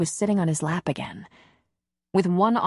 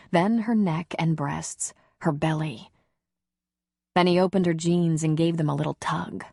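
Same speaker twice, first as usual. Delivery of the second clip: usual speaking voice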